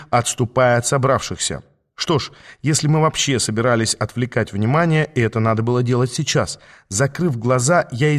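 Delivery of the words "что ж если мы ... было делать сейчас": usual speaking voice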